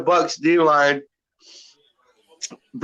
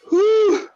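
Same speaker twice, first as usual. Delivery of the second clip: high pained whew. Real, not acted. Blowing out after the hot chip.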